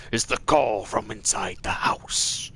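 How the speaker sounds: hushed horror-movie whisper; breathy mock-scary whisper; spooky whisper. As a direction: whisper it like a scary film caller. Acted movie scare line.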